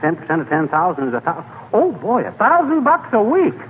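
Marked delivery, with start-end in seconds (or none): 1.7-3.6 high, gleeful rush; getting carried away by the money; greedy delight at imagined riches; excited. Acted. Fibber dreaming of big commission.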